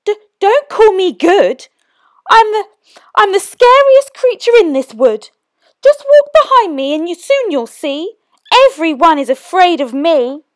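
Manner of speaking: high, loud brave bluff; bluffing mouse. Acted. Mouse bluffs the Gruffalo, high and loud. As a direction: puff yourself up though scared.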